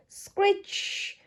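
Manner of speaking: high, sharp screech sound; brakes screech. Acted. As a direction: voice the squealing brakes.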